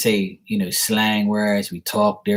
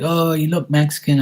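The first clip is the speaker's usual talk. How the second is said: higher-pitched mock realization; imitated onlookers; 7 semitones higher than usual. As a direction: imitate people guessing, voice pitched up. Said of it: "they were like".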